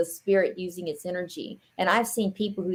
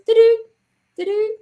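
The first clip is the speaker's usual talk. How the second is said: high loud beeping imitation; rem pod beeps; 6 dB louder than usual. Imitating the rem pod alarm, high beeps. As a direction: mimic the rem pod's high two-note beep. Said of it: "a spirit goes and goes".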